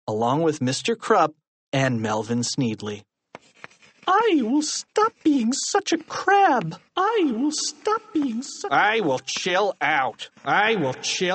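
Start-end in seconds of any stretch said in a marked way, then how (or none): 4.1-8.7 high, slow, droning punishment recital; detention lines recited. A narrator's reading. 8.7-11.4 detention lines recited. A narrator's reading. Another detainee recites lines.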